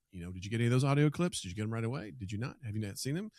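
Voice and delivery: ordinary talk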